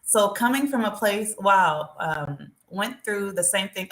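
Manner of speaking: usual speaking voice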